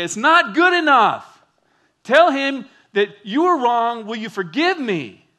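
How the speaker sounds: loud, high, emphatic preacher's rebuke; forceful correction. Retold or imitated. Counselor sternly corrects an apology.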